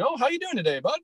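friendly local greeting. Retold or imitated. Michigander imitates friendly locals greeting him, high and cheery. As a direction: chirp a cheery hello way up high like a nice neighbour. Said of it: "super nice and friendly".

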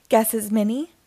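ordinary delivery

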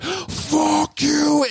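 very high mock shout; imitated crowd yell. Crowd answering the rapper, imitated yell. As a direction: answer like a far-off roaring crowd, pitched high. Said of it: "And they were like".

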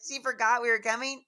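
loud, high question of disbelief; incredulous echo. Retold or imitated. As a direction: throw it back as a stunned question, imitating the family. Family echoes it in disbelief.

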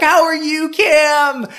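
high, loud MC greeting; high energy. Retold or imitated. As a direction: greet her like a hype host. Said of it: "so high energy".

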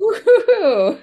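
high, loud laughing cheer; laughing woo-hoo. Real, not acted. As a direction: whoop it through a laugh. Mock cheer while laughing.

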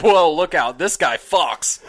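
awed bro voice. Retold or imitated. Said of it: "you're like".

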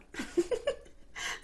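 breathless high-pitched giggle; high laugh. Real, not acted. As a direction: burst into a high, airy laugh. Laughing at her own story.

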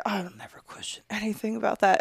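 soft, quick, half-whispered aside; cowed hush. Retold or imitated. Woman recalling keeping quiet around her ex, cowed hush. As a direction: say it small, under your breath.

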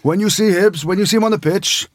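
a playful borrowed Welsh lilt, quoting a big Welsh lock; mock Welsh accent, lightly done. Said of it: "not a bad Welsh accent".

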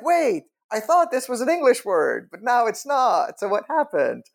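high-pitched, bewildered student; comically lost and high-voiced; baffled protest. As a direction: protest in confusion, voice pitched well up. Acted baffled student reaction.

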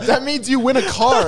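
loud, high prize announcement; game show host. Pretend.

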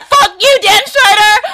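high, loud hammed-up rage; playacted furious shout; mock angry scream. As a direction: yell it like pent-up anger bursting out. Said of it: "What anger did she have to get out?".

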